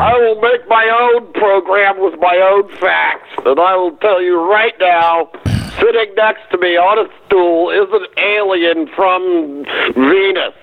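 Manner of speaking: high, far put-on voice of a crank show host. Parody of a conspiracy show host.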